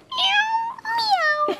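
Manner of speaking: soft, very high meow; cat meowing. Acted. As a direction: mew like a little cat. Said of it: "A cat says".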